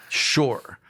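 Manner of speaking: usual speaking voice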